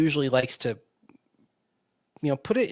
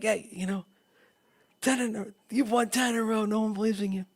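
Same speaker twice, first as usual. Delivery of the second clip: higher, scratchy, quicker coach voice; John Fox scratchy voice; 8 semitones higher, 3 dB quieter, faster than usual. Retold or imitated. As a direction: rasp it out, breathless coach. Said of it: "get my voice scratchy like John Fox".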